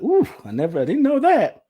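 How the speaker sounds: high, loud note of surprise; taken aback, voice jumping up; surprised realization. Retold or imitated. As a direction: react to startling news, rising high on the last words. Acts surprise at sister's revelations.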